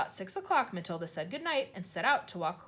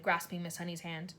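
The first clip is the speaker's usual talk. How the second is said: ordinary delivery, nothing marked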